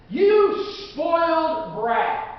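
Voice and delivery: put-on slow, drawn-out older-brother sneer; sneering scold. A touch of older brother sneering at little David. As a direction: spit it out with contempt.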